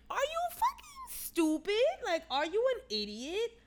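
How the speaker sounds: very high incredulous questions; outraged disbelief. Retold or imitated. Viewer berating the forgiving best friend, incredulous. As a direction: stretch it out high, as if baffled.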